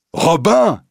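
high, loud cry of the name; joyful cry. A narrator's reading. Young Will falls into Robin's arms, crying out high and loud. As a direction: call out Robin! in delight. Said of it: "s'écrie le jeune homme".

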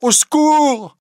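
very high, loud scream for help; a girl screaming for help against the priest; cry for help. Acted. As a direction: scream for rescue. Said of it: "elle se met à crier".

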